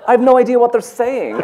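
high, loud bewildered cry; can't believe what you're hearing. Retold or imitated. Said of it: "I was going".

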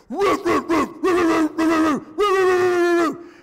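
high, loud yappy dog barks; imitated barking. Dog safe in the car, barking at the Dobermans, high and loud. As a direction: bark like a brave little dog behind glass. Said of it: "Thor turns around and says".

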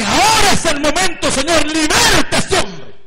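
loud, high, slowed prayer of liberation; preaching prayer. Retold or imitated. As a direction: command it with fervour. (oración de liberación, fuerte y lenta)

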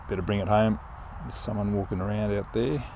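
ordinary delivery

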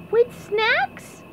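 high, squeaky wide-eyed question; SpongeBob. Acted. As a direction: ask hopefully in a pipsqueak voice. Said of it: "SpongeBob's eyes were huge".

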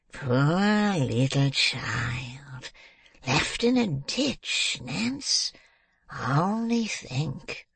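slow, drawn-out mock pity; wheedling old man. A narrator's reading. Old man working on the crying girl, wheedling pity.

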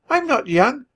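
put-on high, childlike protest; squeaky, emphatic little mouse voice; insistent little nephew. A narrator's reading. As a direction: insist it like an affronted small child. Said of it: "he insisted".

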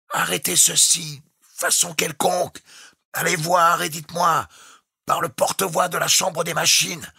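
high, strained, broken shouted phrases; captain shouting in storm. A narrator's reading. The captain shouts clipped orders to Jukes in the storm. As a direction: fight the wind to be heard.

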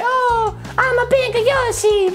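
high, drawn-out toy creature voice; little Yoshi voice. Acted. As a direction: squeak slowly as an excited little critter. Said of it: "Say hello to your little friend".

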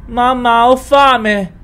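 put-on high, loud child's voice; little daughter calling from upstairs. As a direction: call down from upstairs like a little girl. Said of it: "La voce di Sara riecheggiò".

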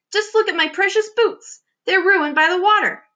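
high, fussy storybook complaint; dismayed cry. A narrator's reading. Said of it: "cried the centipede".

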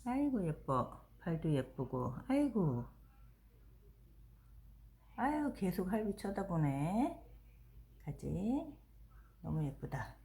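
quiet tender cooing; soft cooing. Real, not acted. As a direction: coo softly to a pet. Cooing softly at dog.

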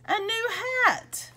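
high, gleeful gift announcement; excited reveal. A narrator's reading. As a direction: present the surprise with a big lift in pitch. Niece presents gift in story.

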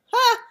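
high, loud scoffing "ha"; scornful ha. Acted. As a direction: scoff it out sharply. Scornful rabbit laugh.

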